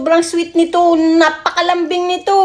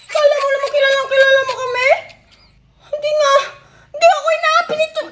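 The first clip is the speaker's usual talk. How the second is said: breathless laughing; 7 semitones higher than usual. Real, not acted. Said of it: "Ba't hinihingal ka?".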